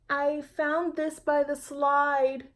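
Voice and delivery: put-on high, loud and slow child's voice; a girl handing in a found toy; proud little child. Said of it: "she told the young man".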